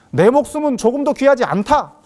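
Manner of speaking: high, loud zealous proclamation; acted-out fervor, rising to a loud finish; fervent declaration. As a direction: declare it like a convert on fire. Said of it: "막 이러면서 돌아다닙니다".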